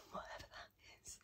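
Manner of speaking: breathy throwaway whisper under the breath; a soft unvoiced whisper to yourself; whispered aside. Real, not acted. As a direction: mutter it as a quick aside. Whispered aside about lanolin.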